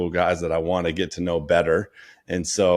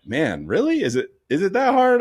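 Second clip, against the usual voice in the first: exasperated disbelief; 12 semitones higher, 3 dB louder than usual. Retold or imitated. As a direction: pitch jumps way up on hard, incredulous. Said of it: "I'm like".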